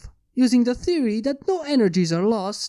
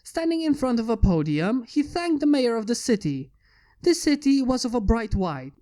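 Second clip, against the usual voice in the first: ordinary delivery, nothing marked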